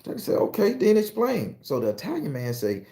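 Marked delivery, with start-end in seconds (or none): 0.3-1.6 high slow demand. Acted. Questioners demand explanation.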